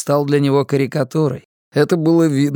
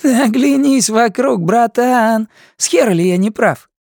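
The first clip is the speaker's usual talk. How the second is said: higher, sneering junkie voice; mocking inmate; 10 semitones higher, 4 dB louder than usual. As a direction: taunt him with a smirk. Guatemalan mocks Karl.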